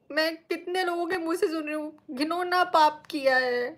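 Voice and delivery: ordinary talk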